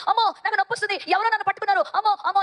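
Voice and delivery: high-pitched mock yelp of pain; acted cry of pain. Pyari cries out as something grips the leg, acted pained yelling. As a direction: cry out high, playing a hurt child. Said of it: "అని అరుస్తుంది".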